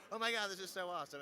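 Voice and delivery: lively acted-out enthusiasm; excited exclamation. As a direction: gush as if retelling a thrill. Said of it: "an experience of".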